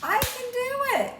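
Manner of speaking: high, loud, proud little voice; proud little girl. Acted. Teacher voicing a proud girl in the book, high and loud. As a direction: chirp it up like a proud child.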